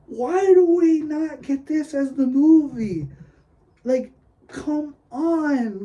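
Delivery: high, drawn-out, loud protest; exasperated plea. Retold or imitated. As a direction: whine it slowly in disbelief, pitched well up. Acts out exasperated reaction to ride.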